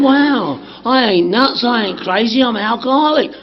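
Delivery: ordinary talk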